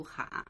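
哈 with a low dipping third tone, hǎ; 哈 as hǎ, not high level hā; name reading. Shown as a demonstration. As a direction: say 哈 as the surname, low hǎ. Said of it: "它读名字的时候是读哈".

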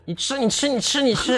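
high, impatient pushy voice; irritated forcing. Retold or imitated. Father retelling pushing the pacifier, impatient. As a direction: jam it at him, out of patience. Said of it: "我就很火".

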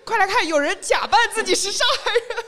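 high, loud mock call to everyone. Mocking the foreigner telling everyone, a high, loud call. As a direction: call out like a gossip showing off a find.

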